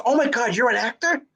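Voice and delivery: high-pitched amazed question; retold astonishment. Co-star learning he's an actor, high mock astonishment.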